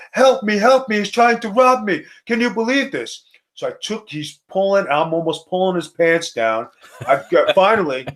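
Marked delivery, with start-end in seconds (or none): 0.1-3.3 retelling a promoter's panicked cry for help; mock yelling for help. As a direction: imitate him screaming that he's being robbed. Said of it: "he's yelling and screaming".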